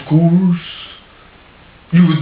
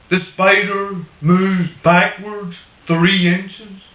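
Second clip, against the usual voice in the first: normal talking voice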